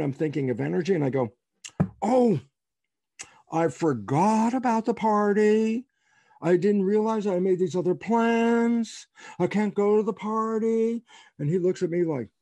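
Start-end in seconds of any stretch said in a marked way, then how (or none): 2.0-11.0 mock regretful excuse. Professor dodging a colleague's faculty party, mock regretful. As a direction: make a hammed-up apology in a higher voice.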